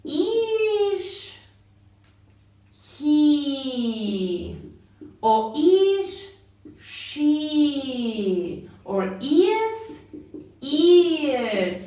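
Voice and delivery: is he, is she, is it, each asked and stressed; teacher modelling questions; question intonation. Shown as a demonstration. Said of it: "En el siguiente sería".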